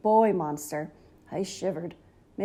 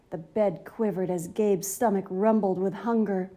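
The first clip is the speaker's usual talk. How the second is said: ordinary delivery, nothing marked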